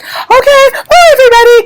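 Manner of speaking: high-pitched, sing-out goodbye; panicky cheery sign-off. Retold or imitated. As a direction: blurt a high, hasty goodbye to cover coming tears. Abruptly ending live before crying.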